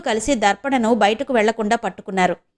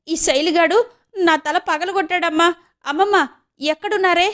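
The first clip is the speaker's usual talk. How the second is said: high-pitched wail for grandma; hurt girl shrieking for help; crying out; 8 semitones higher than usual. Acted. Girl hit on the head cries out to her grandmother, high wail. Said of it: "అంటూ గట్టిగా అరిచింది".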